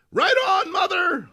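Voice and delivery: high, loud enthusiastic cheer; high loud youth cheering the old lady. Acted. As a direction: cheer her on like a fan. Young person cheers.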